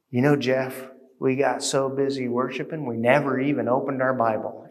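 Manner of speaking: low, soft retelling of a friend's words; retold casual remark. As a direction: quote the friend quietly and matter-of-factly. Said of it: "he said it proudly".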